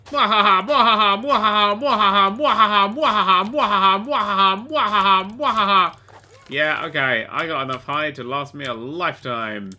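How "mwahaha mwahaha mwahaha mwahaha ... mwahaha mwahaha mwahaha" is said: loud, repeated villain cackle; looping, rhythmic mwahaha cackle; mock evil laugh. Player gloats over the hunted prey, mock evil laugh.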